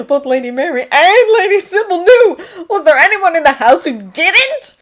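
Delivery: loud, high-pitched incredulity; exasperated dismay. A narrator's reading. Thomas finds the whole house knew, exasperated. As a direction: build to an appalled question. Dismayed thought, secret widely known.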